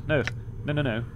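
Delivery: usual speaking voice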